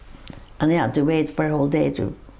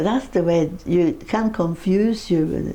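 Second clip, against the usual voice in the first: usual speaking voice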